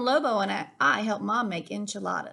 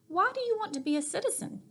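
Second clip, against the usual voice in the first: put-on higher, fast child's question; curious child; 9 semitones higher, faster than usual. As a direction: ask it like a curious little girl. Child asks Lobo at bedtime.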